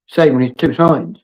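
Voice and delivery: ordinary talk, nothing marked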